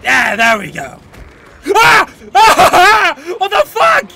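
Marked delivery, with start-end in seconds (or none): 1.8-4.1 loud high scream into a laugh; startled scream. Real, not acted. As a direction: shriek in real fright then laugh. Sudden jump scare in game.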